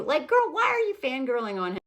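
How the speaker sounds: normal talking voice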